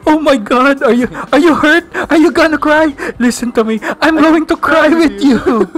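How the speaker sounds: high, over-emotional effeminate voice; hammed-up effeminate. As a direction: gush with mock tearful concern for a hurt teammate. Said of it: "nag-effeminate".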